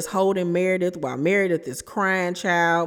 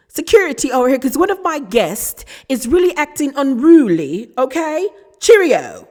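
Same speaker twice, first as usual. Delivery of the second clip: loud, higher-pitched mock British; British accent; 5 semitones higher, 7 dB louder than usual. Meredith calling security on her guest, yelled mock British accent. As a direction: put on an over-the-top British accent, yelling. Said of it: "She started talking in a British accent".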